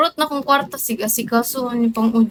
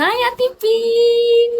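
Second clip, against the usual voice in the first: coworkers' mock greeting; 12 semitones higher, much slower than usual. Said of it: "yung mga kasama ko doon".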